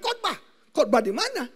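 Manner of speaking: high, sharp "Khotbah?" as a surprised question; God asks with a touch of disbelief. Retold or imitated.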